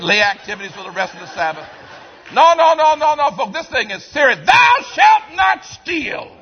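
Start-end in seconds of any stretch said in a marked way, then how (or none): 2.3-4.5 loud, high preacher's cry; full-force preaching. Real, not acted. Preacher thundering the commandment at his congregation, high and loud. 4.5-6.4 high, loud, slow proclamation; shouted commandment. Real, not acted. Preacher proclaiming the commandment to the church, loud and slow. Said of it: "same words whispered later".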